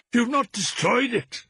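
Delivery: put-on high, urgent old wife's voice; an old mother clutching at hope; frantic old woman. A narrator's reading. Wife urgently questioning husband, high frantic old woman.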